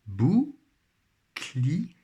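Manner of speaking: "Bouclier" broken into bou, long pause, clier; syllable by syllable. Shown as a demonstration. Teacher rebuilding the word for pupils, syllable by syllable. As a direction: sound out "Bouclier" slowly in separate beats. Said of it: "colorier la syllabe".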